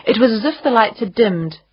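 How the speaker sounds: plain reading voice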